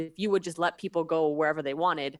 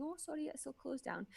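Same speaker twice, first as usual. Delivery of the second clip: high, soft imitation of a Scottish apology; customer service voice; 7 semitones higher, 14 dB quieter than usual. Friend turning passengers away from closed elevators, customer service voice. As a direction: imitate a polite Scottish worker, high and hushed. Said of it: "doing a little customer service thing".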